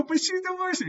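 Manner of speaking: very high-pitched retold objection; acting out a helpless interruption; distraught protest. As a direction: squeak it in disbelief.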